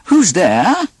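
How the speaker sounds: high little pig voice; a wary pig calling through the door; pig's voice. Acted. Pig asking who knocks, high and wary.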